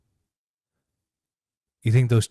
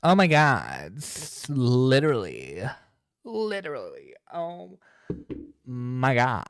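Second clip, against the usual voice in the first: Slay voice; 2 semitones higher than usual. Acted. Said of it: "do the Slay voice".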